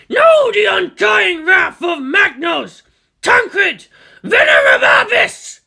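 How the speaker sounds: booming war machine shouting high and slow; dreadnought battle cry. Acted. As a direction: roar it like an ancient war machine. Dreadnought strikes with wrath.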